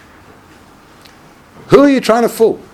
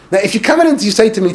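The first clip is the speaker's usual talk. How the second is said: normal talking voice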